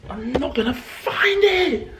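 frustrated whine. Real, not acted. Frustrated search.

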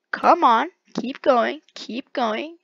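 ordinary talk

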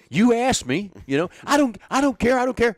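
animated retold quote; mock exasperated. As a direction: act out throwing it back at him. Leo's own quote, raised.

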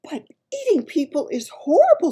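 horrified cry. A narrator's reading. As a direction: cry out as an appalled little girl. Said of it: "Sophie cried".

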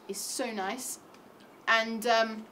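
ordinary talk

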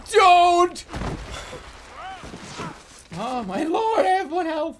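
high, loud alarm; frantic high-pitched gamer yelp; panicked yell. Real, not acted. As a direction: cry out in panic, nearly dead. Nearly dead in the game.

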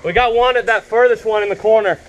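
high, loud call of positions; shouting callouts. Real, not acted. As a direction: yell the enemy position out. Calling enemy positions across field.

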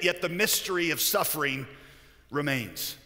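ordinary talk

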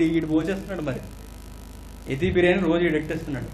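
usual speaking voice

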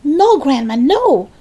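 high, urgent protest; calling back. A narrator's reading. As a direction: call back, begging her to stop. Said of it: "George called back".

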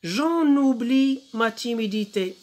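very slow, drawn-out French verse; poem recitation. Shown as a demonstration. Teacher reads the poem to pupils, slow poem recitation. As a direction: declaim it slowly, syllable by syllable. Reading poem aloud in lesson.

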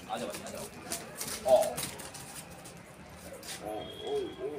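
soft unvoiced whisper to lure the pets; hushed calling to the animals; coaxing whisper. Real, not acted. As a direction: whisper them over, gently.

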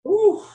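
loud high blown-out whew. Real, not acted. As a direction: blow out a big whew. Coach blowing out mid workout.

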